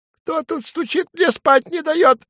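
grumpy little dormouse woken from sleep; high sleepy rodent. Acted. Sleepy creature voice from hollow.

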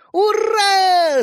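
high, loud cheer of joy; joyful yell. A narrator's reading. Brothers cheering round grandpa, a loud joyful yell. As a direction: shout for pure happiness. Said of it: "завопили братья".

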